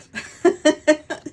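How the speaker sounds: loud, high burst of laughter; real startled laugh. Real, not acted. Reader after an "oh God" at the cards, laughing.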